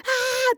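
a piercing high squeal; high excited squeal. Acted. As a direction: squeal like a fan in the crowd. Crowd screams, fan squeals.

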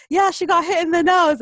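high, loud, insistent repeat; mock announcing. Imitating the guy repeating it to onlookers, called out high.

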